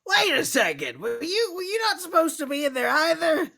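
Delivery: loud, high, startled cry; startled shout. Acted. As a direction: yell in sudden alarm. Player startled by stowaway mole.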